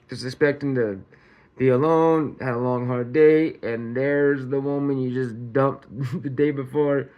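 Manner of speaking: ordinary talk, nothing marked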